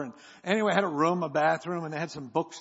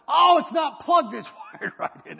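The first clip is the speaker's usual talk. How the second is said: clerk shouting; 10 semitones higher, 10 dB louder than usual. Retold or imitated. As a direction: bellow it out like the clerk.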